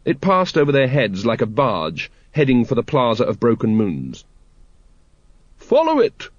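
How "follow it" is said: very high-pitched shouted command; high cry without much extra volume. A narrator's reading. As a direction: call it out high, like a lady giving chase. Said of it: "shouted Lady Ramkin".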